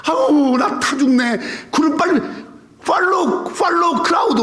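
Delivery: hammed-up impatience, calling ahead; preacher imitating his younger self rushing God; impatient mock pleading. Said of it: "막 이러면서".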